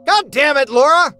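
high, loud startled yell; exasperated shout. A narrator's reading. Man startled by Laura at the window, angry shout. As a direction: yell at someone who startled you. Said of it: "I shouted".

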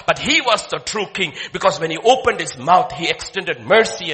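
loud, driving sermon delivery; full-force preaching. Acted. Pastor preaching loudly to the congregation about kings.

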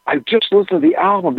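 excited. Retold or imitated. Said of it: "super excited".

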